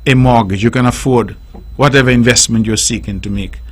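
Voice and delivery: low, measured banker voice granting the loan; a hint of a bank manager, pitched low; bank officer. Bank tells the pooled-income couple they can afford a mortgage, in a low banker voice. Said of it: "the bank then says".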